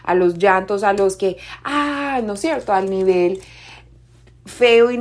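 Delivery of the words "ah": high, restrained mock wail; mock cry. Shown as a demonstration. Speaker illustrates emotions exploding into cries, restrained mock wail. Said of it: "a los gritos, a los, a los llantos".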